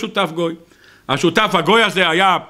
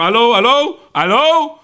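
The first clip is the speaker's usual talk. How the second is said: answering a phone, raised questioning hello; mock phone answering; 3 semitones higher, 3 dB louder, slower than usual. Man pretends to get a call to slip away, mock phone hello.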